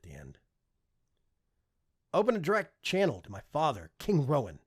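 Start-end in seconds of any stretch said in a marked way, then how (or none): 2.1-4.6 commanding. Acted. Prince commands his AI.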